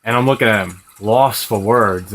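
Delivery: ordinary delivery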